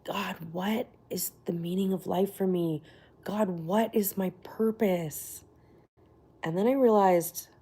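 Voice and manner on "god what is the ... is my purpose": slow, whispered plea to God; soul-searching whisper. Retold or imitated. She whispers her old questions to God, slow and searching. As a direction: murmur it like a lost soul asking the sky. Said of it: "I used to be like".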